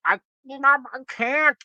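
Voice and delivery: high, slow stammered protest; high-pitched imitation of someone begging off; mock helpless refusal. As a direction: play-act a feeble, drawn-out refusal.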